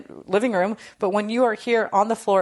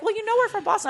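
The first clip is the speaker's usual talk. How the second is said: friend's excuse in a much higher mock voice; mimicking friend; faster than usual. Imitating Stacy shrugging off the Boston jerseys, in a high mock voice.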